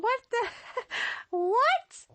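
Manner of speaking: stunned laughing disbelief. Retold or imitated. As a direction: laugh in shock at the absurd. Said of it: "I'm like".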